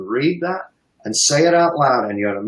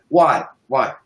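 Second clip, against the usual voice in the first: ordinary talk, nothing marked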